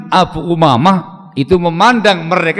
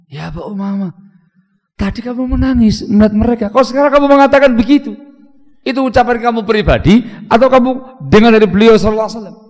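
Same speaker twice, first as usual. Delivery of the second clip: rising, higher, quicker questioning; puzzled challenge; 6 semitones higher, 4 dB louder, faster than usual. Retold or imitated. Student Abu Ghalib confronting his teacher in disbelief, puzzled rising questions. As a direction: press him, baffled by the change.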